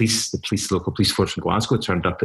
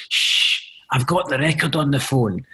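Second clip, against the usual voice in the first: hushing shh then a higher tipsy pub voice; drunk pub caller; 7 semitones higher than usual. Retold or imitated. Punter calls the newsroom from the pub, high tipsy voice. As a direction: play a rowdy punter shushing the pub. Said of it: "you'd just hear suddenly".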